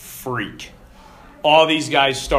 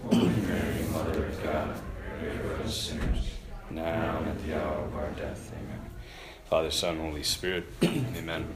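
prayer; 10 semitones lower, 11 dB quieter than usual. Real, not acted. Leads Hail Mary prayer.

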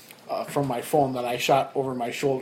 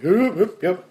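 high, louder half-asleep yeps; mumbling sleepy friend; 4 semitones higher, 4 dB louder than usual. Retold or imitated. Sleeping friend ignoring the driver, sleepy brush-off. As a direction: brush off the shove without waking.

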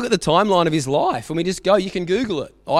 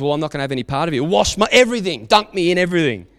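1 rushed eager quote; 3 semitones higher, faster than usual. Retold or imitated. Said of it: "And Jesus goes".

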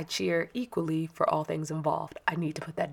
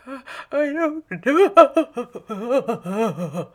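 put-on crying child; 6 semitones higher, 9 dB louder, much slower than usual. Son answers coach while crying.

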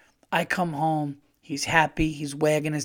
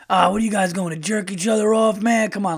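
put-on loud, higher drunk taunt; drunk yelling; 7 semitones higher, 5 dB louder than usual. Drunk guy teasing comedians loudly across the casino. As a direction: tease them across the casino.

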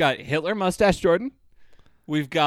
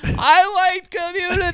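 shouting; 13 semitones higher, 4 dB louder, slower than usual. Retold or imitated. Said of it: "He just kept shouting".

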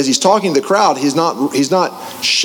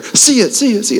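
higher, fading echo; 8 semitones higher than usual. Retold or imitated. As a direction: let it echo away. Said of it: "I hear".

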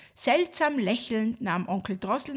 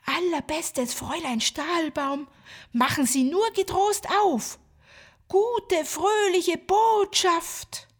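tiny voice; 6 semitones higher than usual. Acted. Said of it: "ein feines Stimmchen".

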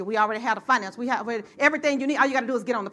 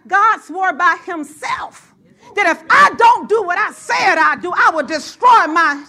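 louder, higher, slow shouted preaching; full-force preaching; 6 semitones higher, 8 dB louder, slower than usual. Acted. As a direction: build it to a roaring climax. Preaching climax.

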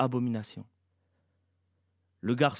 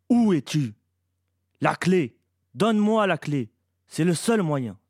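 loud, raised monster's demand; beyond-the-grave shout; 5 semitones higher, 8 dB louder than usual. A narrator's reading. Creature demands the key from outside, shouting. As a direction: cry out fiercely from beyond the grave. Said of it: "la voix d'outre-tombe cria si fort".

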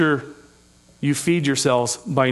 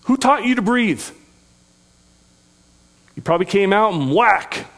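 normal talking voice

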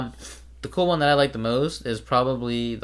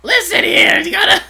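much higher, loud squawky Iago voice; Gilbert Gottfried; 9 dB louder than usual. Retold or imitated. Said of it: "he was gonna pick Gilbert Gottfried".

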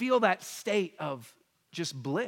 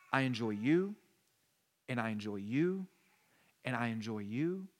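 tender voice of God; 6 semitones lower, 5 dB quieter than usual. Acted. Voicing God tenderly to each person.

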